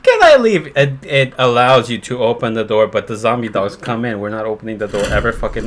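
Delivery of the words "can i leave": high, loud mock of his own dumb question; hammed-up whiny self-parody; mocking himself. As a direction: mimic yourself as a clueless idiot. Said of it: "my stupid dumbass is like".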